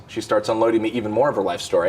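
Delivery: normal talking voice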